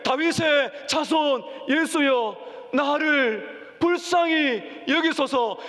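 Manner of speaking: high, slow drawn-out cry for mercy. Acted. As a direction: stretch each word, begging over the crowd. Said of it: "그가 더 크게 소리질러".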